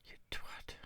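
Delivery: breathy whisper under the breath; whispered insult. Real, not acted. Chess player cursing his opponent, whispered. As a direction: whisper it so nobody hears.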